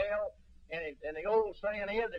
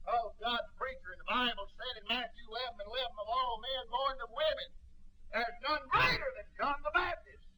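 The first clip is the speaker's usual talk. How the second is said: preaching at full force; 4 semitones higher than usual. Acted. Preacher telling the congregation about Herod, building hard. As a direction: drive the story up toward the climax.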